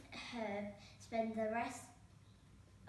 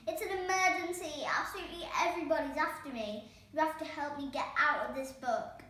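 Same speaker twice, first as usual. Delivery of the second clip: louder, higher, fast panicked plea; frantic yell; 5 semitones higher, 8 dB louder, faster than usual. A narrator's reading. Herb yells to the fairy godmother for help. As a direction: yell it, everyone is chasing you. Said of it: "yelled Herb".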